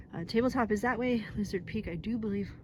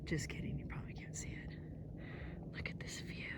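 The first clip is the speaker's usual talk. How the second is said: hushed whisper; soft breathy whisper at the top; 3 semitones higher, 8 dB quieter than usual. Real, not acted. Quiet at the summit view.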